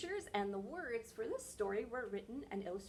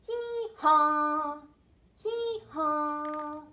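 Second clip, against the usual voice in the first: sung-out, two-note hee haw; donkey bray imitation; 5 semitones higher, 14 dB louder, much slower than usual. Shown as a demonstration. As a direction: bray like a donkey, holding each half. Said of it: "A donkey says".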